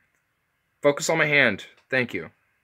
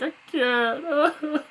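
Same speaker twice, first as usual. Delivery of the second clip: high, small helpless voice; whiny defeated voice. Retold or imitated. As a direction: squeak out a defeated whine. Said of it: "I'm like".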